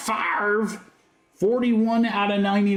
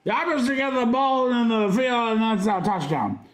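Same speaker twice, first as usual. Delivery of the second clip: a lightly voiced, higher old football commentator; terrible John Madden; 5 semitones higher than usual. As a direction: toss off a bad play-by-play legend voice. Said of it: "it's a terrible John Madden impression".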